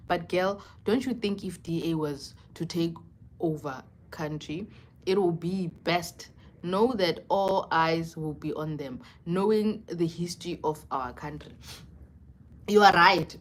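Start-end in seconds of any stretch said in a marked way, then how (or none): none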